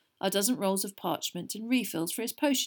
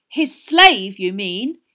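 shrill anger; 13 dB louder, much slower than usual. A narrator's reading. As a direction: throw the correction back indignantly. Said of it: "her voice rising shrilly".

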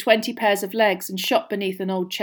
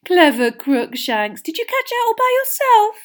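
cooing pet talk; 14 semitones higher, 8 dB louder than usual. A narrator's reading. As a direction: coo loudly over a beloved pet. Hermione praises her cat.

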